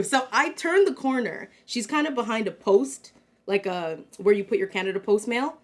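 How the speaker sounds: ordinary talk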